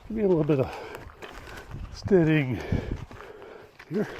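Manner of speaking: breathing hard on the climb; winded, puffing between words. Real, not acted. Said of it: "don't worry about me breathing hard".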